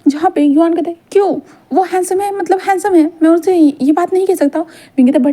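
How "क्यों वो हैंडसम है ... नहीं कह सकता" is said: higher, lightly voiced little boy pleading his case; innocent child protesting. Little Yuvan argues with his dad, higher childlike protest. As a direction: sound like a puzzled kid defending himself.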